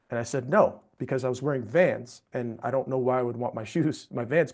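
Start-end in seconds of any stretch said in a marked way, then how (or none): 0.5-0.8 a sharp, louder, higher no; refusing the insistent shoe shiner, firm. Retold or imitated. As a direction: cut him off flat. Refusing the vendor firmly.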